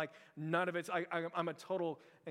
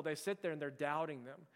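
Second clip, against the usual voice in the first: ordinary talk, nothing marked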